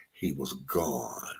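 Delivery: low, slow and heavy; sad. Real, not acted. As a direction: let it drop like heartbreak. Lover left without goodbye.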